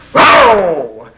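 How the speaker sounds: playful lion roar to amuse the congregation; mock lion roar. Acting out Leo the lion.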